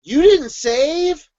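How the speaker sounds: high, very slow incredulous question; mock disbelief. Someone learning the writer didn't save, mock horrified. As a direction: stretch it out high, as if appalled. Same acted question repeated.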